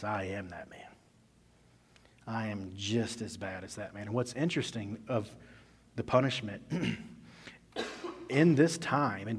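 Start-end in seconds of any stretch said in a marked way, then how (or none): 0.0-4.0 dawning guilt. Retold or imitated. David confessing to himself, a soft, broken admission. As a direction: say it as the truth sinks in. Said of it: "he realizes".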